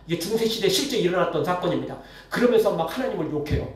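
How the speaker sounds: normal talking voice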